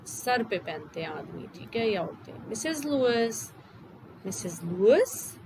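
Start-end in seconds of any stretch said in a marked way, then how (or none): 2.5-5.4 polite small man. Acted. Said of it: "he inquired politely".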